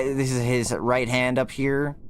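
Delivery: ordinary talk, nothing marked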